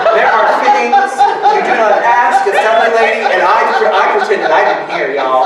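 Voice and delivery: loud, high, laughing through the words; can barely get it out for laughing; real laughter in the voice; laughing while talking. Real, not acted.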